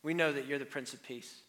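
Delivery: soft, low opening prayer. Acted. Pastor praying softly over the church before preaching. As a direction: pray quietly over the congregation.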